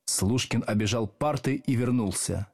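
ordinary delivery, nothing marked

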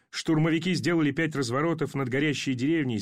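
usual speaking voice

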